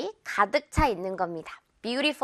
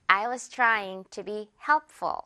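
slow demo; much slower than usual. Shown as a demonstration. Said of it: "가벼운 깃털 넣어주면 돼요".

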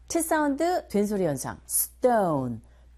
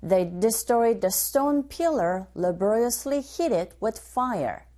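slow, careful drill reading; teacher modelling the phrase slowly; slow model line; much slower than usual. Shown as a demonstration. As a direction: say it slowly for students to copy. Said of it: "One more time".

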